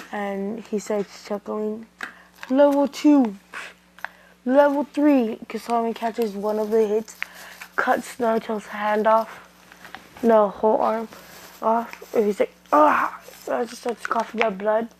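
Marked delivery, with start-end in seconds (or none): 2.5-5.4 loud, high countdown of power levels; raised, punchy battle callout; attack call. Acted. 12.7-13.2 loud, high-pitched "ah" of pain; acted anime hurt cry. Character cries out when hurt.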